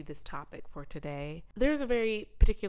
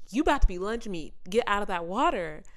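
1 urgent mock yell; 3 semitones higher, 7 dB louder than usual. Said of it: "I'm over here like".

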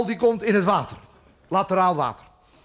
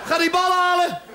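rude Jesus outburst; 5 semitones higher than usual. Acted. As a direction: bark it at Petrus like a fed-up boss. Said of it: "zoals Jezus dat zeggen kan".

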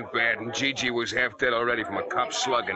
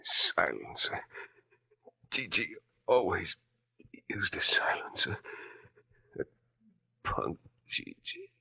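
faint breathy dying gasps; dying whisper. Acted. Shot man whispering his last words to the detective, dying. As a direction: fade out with each word.

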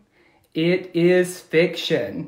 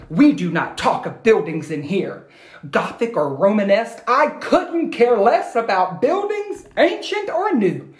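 higher, prim lecture tone; stern lecturing teacher; 5 semitones higher, 3 dB louder than usual. Acted. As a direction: lay down the rule like a strict teacher. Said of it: "in her lecture tone".

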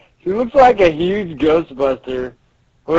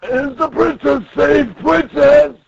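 put-on big old guy calling out, higher-pitched; 8 semitones higher, 5 dB louder, slower than usual. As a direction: ask urgently after the princess. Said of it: "the big old guy comes in".

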